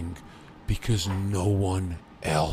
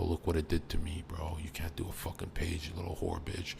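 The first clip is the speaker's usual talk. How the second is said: menacing hush; 3 semitones lower, 9 dB quieter than usual. Retold or imitated. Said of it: "And I go".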